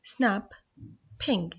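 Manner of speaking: snap, ping with a pause between; "snap" then "ping", each set apart; split into syllables. Shown as a demonstration. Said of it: "cut the word snapping into syllables".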